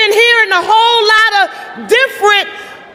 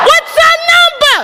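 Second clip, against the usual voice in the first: much higher and louder, shouted chant question; full-voice rally call; 8 semitones higher, 3 dB louder than usual. Real, not acted. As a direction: shout it out to rouse the crowd. Rally call after whispered practice.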